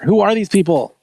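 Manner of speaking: ordinary delivery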